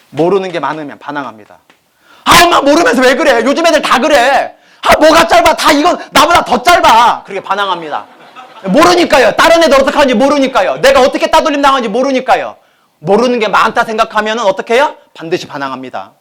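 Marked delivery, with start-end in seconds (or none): 2.2-7.2 high, loud, whiny talking back; a daughter snapping at her mother over a short skirt, high and loud; rebellious teen. Retold or imitated. 8.6-15.0 higher and louder, hammering each line; emphatic preaching. Acted.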